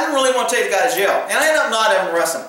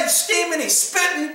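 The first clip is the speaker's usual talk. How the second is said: usual speaking voice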